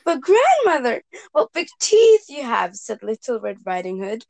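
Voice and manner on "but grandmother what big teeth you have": quivering voice. Acted. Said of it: "Her voice quivered slightly".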